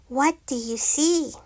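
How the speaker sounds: plain reading voice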